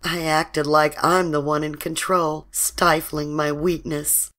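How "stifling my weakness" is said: ordinary delivery, nothing marked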